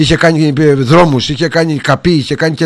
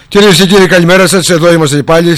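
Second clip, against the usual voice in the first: announcer greeting; 4 semitones higher, 6 dB louder than usual. Real, not acted. Radio host greets listeners.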